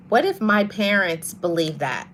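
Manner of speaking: ordinary talk, nothing marked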